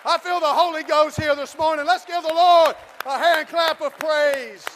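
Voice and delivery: from plain speech to a loud, high preaching shout; building sermon. Acted. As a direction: rise steadily into full-force preaching.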